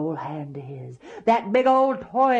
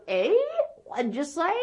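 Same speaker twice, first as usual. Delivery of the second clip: put-on high, questioning old-man "eh?"; hard-of-hearing grandpa; 9 semitones higher than usual. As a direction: ask as if you didn't catch it. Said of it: "hard of hearing like Grandpa".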